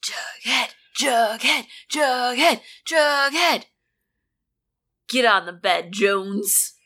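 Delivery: high, building whisper-yell; urgent hushed yell, rising; stage whisper. Acted. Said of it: "whisper yelling".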